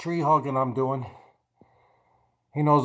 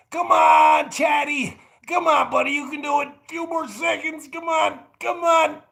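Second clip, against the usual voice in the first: holding a plank; 11 semitones higher than usual. Real, not acted. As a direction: urge yourself on mid-plank. Urging self through plank.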